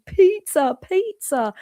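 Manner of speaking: high, loud imitation of a husband yelling; retold shouting. Husband shouting to wife on skis, mock shout. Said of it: "my husband shouting".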